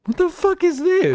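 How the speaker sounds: can't believe what he just heard; shocked disbelief. Real, not acted. Streamer reacting to senator reading explicit book, in shocked disbelief.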